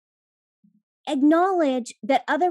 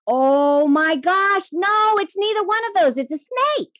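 much higher, louder, amazed; excited; 8 semitones higher, 7 dB louder than usual. Retold or imitated. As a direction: gasp out the discovery. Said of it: "and he's like".

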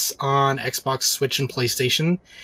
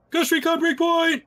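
hyped announcer; 14 semitones higher than usual. Acted. Hyped game title call.

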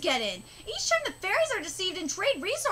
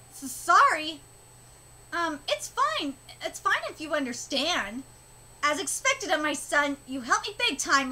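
ordinary talk

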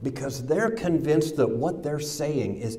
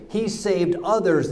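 mocking; 6 semitones higher than usual. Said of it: "mocked him".

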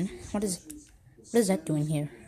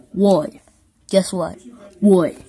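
shouting; 10 dB louder, slower than usual. Acted. Loud back-and-forth exclamations.

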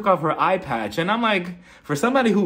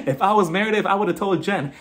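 usual speaking voice

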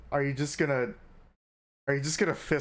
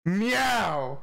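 a loud playful meow; loud cat meow; 3 semitones higher, 3 dB louder than usual. Pretend. Entering the passcode, a loud playful meow. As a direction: belt out a cartoon meow. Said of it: "The passcode is meow".

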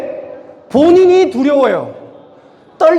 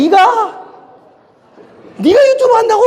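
mocking disbelief; 8 semitones higher than usual. People mocking his YouTube plan.

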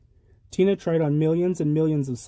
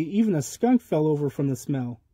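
ordinary delivery, nothing marked

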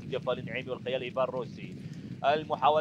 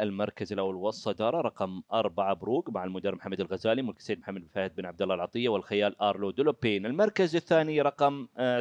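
lower, formal results announcement; booming prize announcer; 4 semitones lower, 3 dB louder than usual. Acted. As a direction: announce the winners with ceremony. Reads official results after race.

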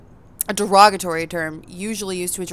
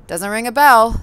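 louder, brushing the name off; loud dismissive scoff; 4 semitones higher, 9 dB louder than usual. Acted. Hooded vampire dismisses the patron's name, loudly. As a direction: wave the name away.

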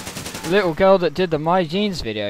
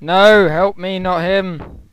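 loud, higher desperate plea; frantic shout; 3 semitones higher, 6 dB louder, slower than usual. Real, not acted. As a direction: yell for help in panic. Medic helps someone else.

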